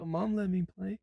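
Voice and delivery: put-on high, soft child whine; small voice appealing to mom's rule; protesting kid. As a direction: plead like a little daughter. Co-host plays daughter protesting.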